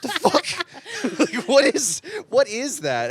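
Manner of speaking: high, loud laughing outrage; laughing disbelief. Real, not acted.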